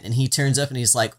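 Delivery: ordinary delivery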